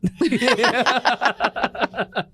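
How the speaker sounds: bright burst of laughter; laughing. Real, not acted. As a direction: laugh at your own joke. Laugh at own joke.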